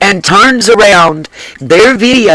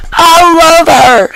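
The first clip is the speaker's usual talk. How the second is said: bursting laugh, then slow, higher I love her; delighted laugh; 10 semitones higher, slower than usual. Real, not acted. Host reacting to clip, laughing with slow adoring gush.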